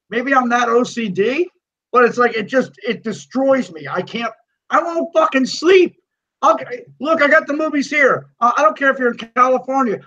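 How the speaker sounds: high-pitched, exasperated comic tirade; worked-up rant. Real, not acted. Podcast host sets co-host straight on watching trilogies in order, worked-up rant. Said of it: "that's my rant for the week".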